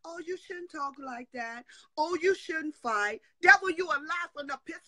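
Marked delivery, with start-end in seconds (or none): none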